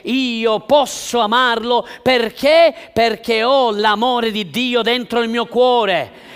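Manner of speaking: loud, high, fervent preaching; preaching at full force. Acted. Pastor exhorting the church on love, preaching at full force. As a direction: drive it home to the congregation.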